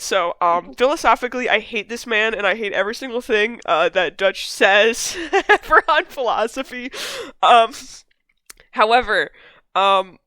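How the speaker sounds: ordinary delivery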